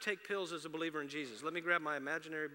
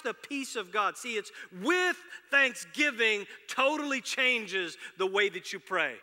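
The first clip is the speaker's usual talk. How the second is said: louder, higher, building fervour; full-force preaching; 8 semitones higher, 11 dB louder than usual. Acted. Pastor rallies the congregation about being thankful, preaching loud. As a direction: preach it out to the back row.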